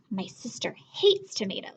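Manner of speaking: confiding whisper to the listeners; whispered aside. Acted. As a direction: lean in and whisper it.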